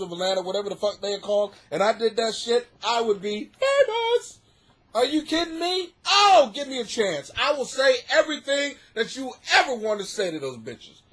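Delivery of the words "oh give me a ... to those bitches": ordinary talk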